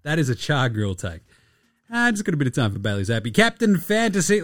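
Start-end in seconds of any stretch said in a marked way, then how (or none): 3.3-4.4 segment title call. Acted. Announcing fantasy segment title.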